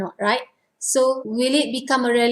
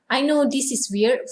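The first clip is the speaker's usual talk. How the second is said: usual speaking voice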